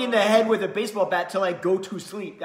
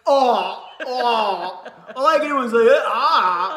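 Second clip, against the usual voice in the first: pained groans; 3 semitones higher, 10 dB louder, slower than usual. Retold or imitated. Blinded by phone flashlight, in pain.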